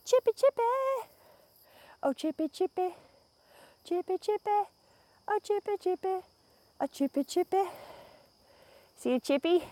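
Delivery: high sing-song coo; calling a chipmunk. Real, not acted. Vlogger calling to chipmunk on trail, high sing-song coo. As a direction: coo up high to the little critter.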